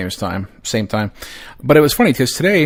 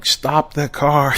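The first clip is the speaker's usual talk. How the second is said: slow, drawn-out half-shouted call; mock yell; 4 semitones higher, much slower than usual. Dad chasing the car, slow drawn-out mock yell. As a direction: call out slowly after the car. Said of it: "I'm yelling".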